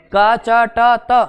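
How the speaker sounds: का चा टा ता slow and loud, each syllable stressed. Shown as a demonstration. Teacher breaking a mnemonic into loud slow syllables. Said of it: "ऐसे याद कर लो".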